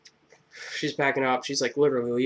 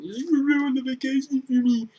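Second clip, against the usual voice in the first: high whiny mock complaint; 12 semitones higher than usual. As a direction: squeal it like a spoiled whiner. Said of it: "teacher scolding, retold".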